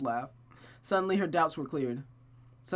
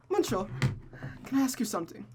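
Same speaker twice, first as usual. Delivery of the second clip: higher playful retake; 9 semitones higher than usual. Acted.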